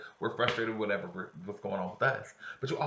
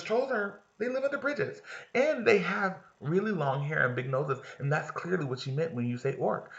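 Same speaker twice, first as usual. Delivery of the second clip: lightly voiced know-it-all, higher; exasperated lecturing; 8 semitones higher, 4 dB louder than usual. Kaylee lecturing Lauren about trolls, mildly exasperated. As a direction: explain it like she's fed up. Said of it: "she says".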